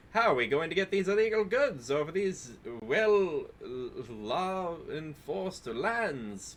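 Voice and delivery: put-on old newsreel announcer, drawn out; 1950s broadcaster voice. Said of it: "nineteen fifties, like, broadcaster voice?".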